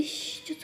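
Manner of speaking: soft, breathy shh; gentle comforting shush; soothing shush. Acted. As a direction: hush someone who is crying. Hushing a crying partner.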